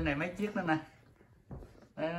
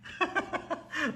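high-pitched amused laugh; burst of laughter; 13 semitones higher than usual. Real, not acted. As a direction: crack up laughing. Amused at the spilled junk. (cười phá lên)

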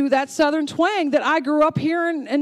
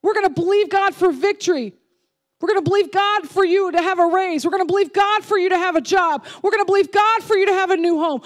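raised, high, rapid-fire preaching; fervent faith declaration; 5 semitones higher than usual. Acted. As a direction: build a driving, repeated declaration of faith. Preacher voices church members' faith declarations.

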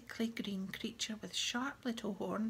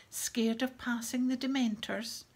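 loud, higher-pitched jeer; taunting shout; 4 semitones higher, 7 dB louder than usual. A narrator's reading. Bully jeering across the entrance hall, shouted taunt. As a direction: goad him loudly. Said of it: "shouted Malfoy".

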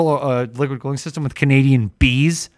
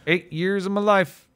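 slow Southern twang on "life"; Southern accent; 7 semitones higher, slower than usual. Shown as a demonstration. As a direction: drawl it out like Arkansas. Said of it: "Southern accents, I can do a decent one".

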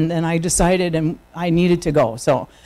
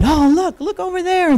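high, eager call to look; excited pointing out; 11 semitones higher than usual. Retold or imitated. Neighbor pointing out garage sale.

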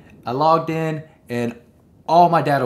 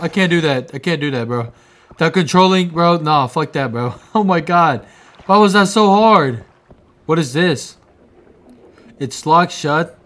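ordinary talk, nothing marked

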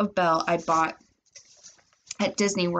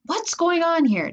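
higher, stretched-out demand; startled, stern father; 10 semitones higher, slower than usual. A narrator's reading. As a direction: demand an explanation from the doorway. Father arriving at the row.

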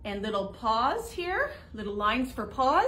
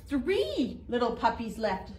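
usual speaking voice

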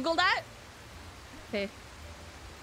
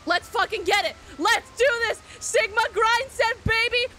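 loud, higher-pitched pep talk; loud rallying cheer to yourself; hyped-up; 6 semitones higher, 5 dB louder than usual. Real, not acted. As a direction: psych yourself up at full energy. Hyping herself up to climb.

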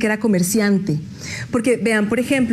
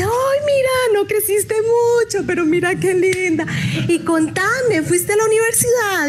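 much higher, sugary teacher voice; sweet old teacher; 9 semitones higher than usual. Retold or imitated. The old teacher greets her former pupil, high and sugary. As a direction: coo like a doting schoolteacher.

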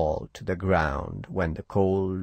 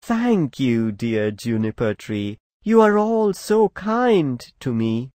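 loud, high, happy thanks; overflowing thanks, up high; grateful little bird; 6 semitones higher, 9 dB louder than usual. Acted. Little bird thanking the juniper, high and happy.

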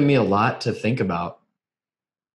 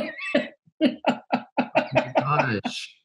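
a long, drawn-out, much higher "Oh my gosh"; stunned gasp; 14 semitones higher, 4 dB quieter, much slower than usual. Real, not acted. Host stunned by the mother's story, slow high gasp. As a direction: let the words stretch out in disbelief.

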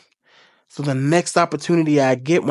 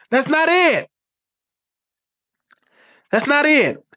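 emphatic denial; 9 semitones higher, slower than usual. Real, not acted. Rejecting manifesting, heated.

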